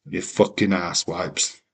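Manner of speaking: usual speaking voice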